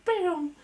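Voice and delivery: loud, high mock gunshot "bam"; punchy comic gunshot noise; gunshot imitation. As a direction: fire off a sudden high bang. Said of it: "she just goes".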